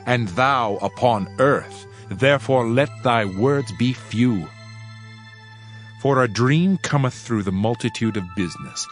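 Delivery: plain reading voice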